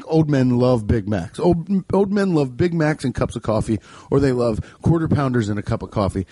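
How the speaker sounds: normal talking voice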